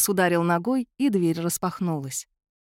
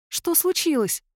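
put-on high, distant girl's voice; 9 semitones higher than usual. A narrator's reading. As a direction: ask high and distant, like a young girl. Girl answers from afar.

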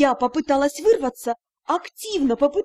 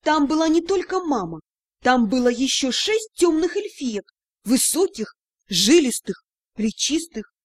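usual speaking voice

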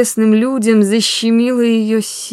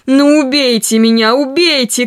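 a loud, higher, desperate cry; shouting; 5 semitones higher, 4 dB louder than usual. A narrator's reading. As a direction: hurl it at him in hysterics. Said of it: "крикнула Ольга Ивановна". (отчаянный громкий крик)

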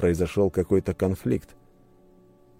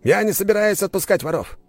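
high-pitched, agitated tirade; heated, raised nobleman's demand; angry duke; 12 semitones higher, 3 dB louder than usual. A narrator's reading. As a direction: indignantly insist on the accusation. Duke angrily demands a search.

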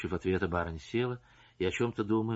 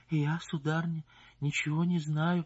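about to cry; 7 semitones higher than usual. Acted. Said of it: "вдруг заплакала".

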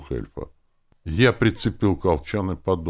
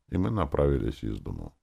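ordinary delivery, nothing marked

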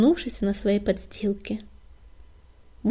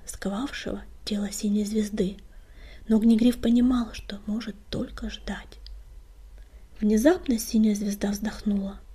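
ordinary delivery, nothing marked

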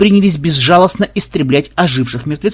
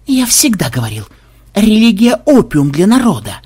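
mild growl rising in pitch; understated menacing declaration; 6 semitones higher than usual. A narrator's reading. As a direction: snarl it as a defiant slogan. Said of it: "прорычал Ксен".

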